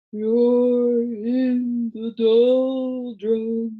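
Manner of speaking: slow, drawn-out, far-off wail; a faraway creature wailing each word; wailing distant voice. A narrator's reading. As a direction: moan it out slowly like a voice from far away. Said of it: "wailed a voice that sounded far away".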